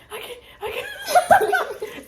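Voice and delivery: high, loud, slow sob-laugh; crying can't talk. Retold or imitated. Telling Maya in the car, crying so hard she can't talk. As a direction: choke it out through tears. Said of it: "you can't talk and you trying to talk".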